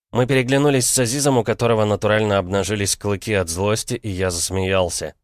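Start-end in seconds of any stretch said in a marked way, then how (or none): none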